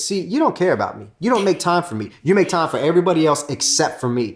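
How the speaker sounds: high, hurt accusing whine; guilt-trip her with a wounded voice; whiny complaint. Retold or imitated. Husband guilt-tripping his wife, high whiny complaint. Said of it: "I would say something like this".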